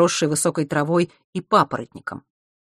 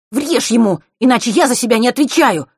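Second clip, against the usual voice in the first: loud, higher furious order; 7 semitones higher, 7 dB louder than usual. A narrator's reading. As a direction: lose your temper. Furious brother demanding a beating.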